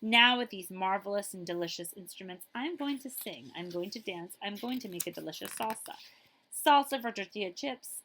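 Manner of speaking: ordinary talk, nothing marked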